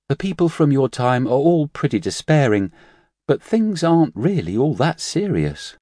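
light, high nurse voice; young nurse voice. Acted. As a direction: play the cheerful young nurse. Nurse's voice in narration.

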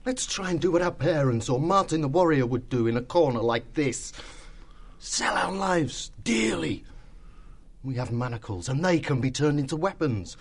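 rally the others for a last fight; resolute. A narrator's reading.

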